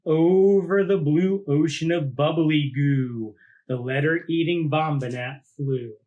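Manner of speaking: put-on lively read-aloud for children; story narration. A narrator's reading. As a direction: tell it like a picture book to a class. Reads picture book aloud.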